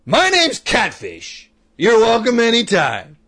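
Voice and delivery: slow, high and loud neighbour; gruff neighbour voice. Retold or imitated. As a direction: play the friendly biker neighbour. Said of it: "And he goes".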